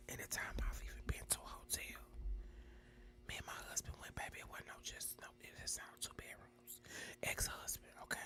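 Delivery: hushed gossip whisper. Real, not acted. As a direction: confide it in a whisper.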